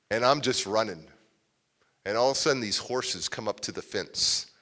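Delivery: ordinary talk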